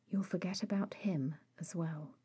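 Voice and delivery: hushed and gentle, barely voiced; soft whisper. Bones speaking gently to the student nurse, whispered. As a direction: murmur it to her tenderly.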